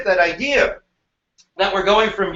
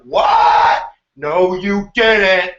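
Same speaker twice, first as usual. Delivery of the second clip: shocked outrage; 5 dB louder, much slower than usual. Retold or imitated. Teacher voicing the British reacting to the tea dump, shocked. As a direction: gasp in disbelief, then drag out the no. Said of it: "The British are like".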